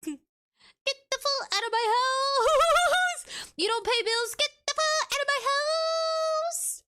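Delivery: high, slow, hammed-up yell to kick out a freeloading ghost; mock angry yell. Kicking a ghost out of her house, mock furious yell. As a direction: stretch it out like a furious landlord. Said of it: "I would say".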